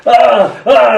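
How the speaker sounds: two high, loud screams; a ball's cry of alarm, acted out; screaming. A narrator's reading. Poet voices the ball caught in the collie's mouth, screaming. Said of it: "screams the ball".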